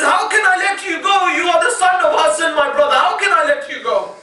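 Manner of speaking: high shouted lament; shouted mourning lament, voice up high; anguished cry. Acted. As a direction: cry it out as if refusing to let him go.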